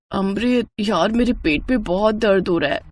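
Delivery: in pain. Acted. Midnight stomach pain.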